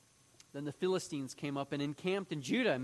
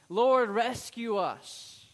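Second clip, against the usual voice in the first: a slow, higher, louder mock plea to the Lord; pleading cry; 7 semitones higher, 8 dB louder, much slower than usual. Preacher voicing Israel crying to the Lord, a slow, higher mock plea. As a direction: draw out the cry like a suffering people.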